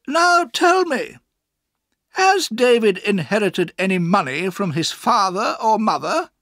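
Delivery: high, loud interrogation; questioning aunt. A narrator's reading. Aunt interrogates Mister Murdstone.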